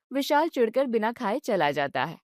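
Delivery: ordinary delivery, nothing marked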